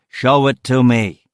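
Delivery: loud, slow, emphatic command; insistent order. Acted. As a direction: order it word by word, brooking no argument. Boss insists over evacuation advice.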